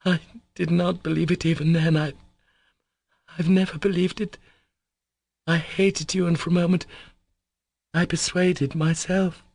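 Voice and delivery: a woman confessing hatred and love at once; Katya's confession. A narrator's reading. Katya's lines in the novel.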